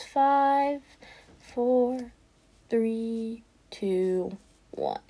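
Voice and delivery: soft, drawn-out count, pitched high; slow countdown. Real, not acted. Gamer waits for next diamond, slow drawn-out countdown. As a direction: stretch each number out, easing down at the end.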